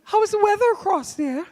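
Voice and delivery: light falsetto disguise. Pretend. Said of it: "even though I'm saying".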